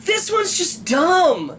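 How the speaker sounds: exasperated verdict. Real, not acted. Fan ranting to co-host about a bad episode, exasperated. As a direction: slowly lean on every word in disbelief.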